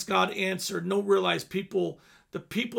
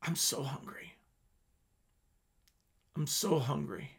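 hushed confession; 5 semitones lower, 7 dB quieter than usual. Retold or imitated. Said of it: "he'd just look at me and go".

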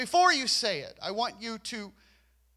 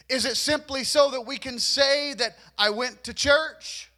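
ordinary delivery

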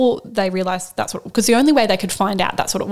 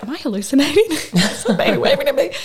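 higher, laughing in amazement; laughing disbelief; 6 semitones higher than usual. Retold or imitated. Mother watching the ultrasound, laughing in disbelief. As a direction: laugh through the wonder of it. Seeing the baby wave on ultrasound.

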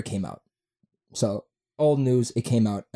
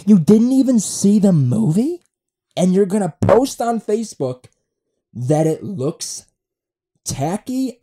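high, loud accusing question; indignant rant; 8 semitones higher, 6 dB louder than usual. Acted. Podcaster scolding absent friend over Facebook, indignant. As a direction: take your friend to task, loudly.

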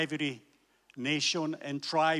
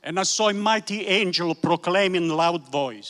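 raised, ringing preacher's reading; preaching scripture. Acted. Preacher reading Revelation to the congregation, raised and ringing. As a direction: proclaim the verses to the hall.

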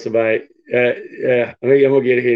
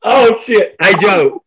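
excited yell; 6 semitones higher, 4 dB louder, much slower than usual. Real, not acted. Host reacting to rant.

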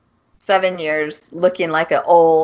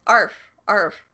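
fake dog bark. Shown as a demonstration. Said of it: "they're not even trying to sound like a dog".

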